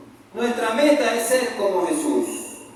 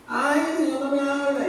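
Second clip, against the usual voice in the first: mock complaint; 5 semitones higher than usual. Preacher mimicking a believer who neglects the Bible, mock complaint. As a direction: imitate a self-pitying believer complaining.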